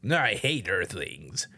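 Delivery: lightly voiced sulky grump; slow, dismissive brush-off in a lightly put-on voice; an angry alien dismissing the others, mildly sulky; grumpy character voice. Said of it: "angry guy is like".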